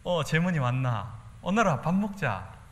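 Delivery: slow, friendly Gyeongsang welcome; friend's mother in Gyeongsang dialect. Retold or imitated. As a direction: welcome a child home in Daegu dialect. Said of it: "친구 집에 가면요". (친구 엄마가 반기는 대구 사투리)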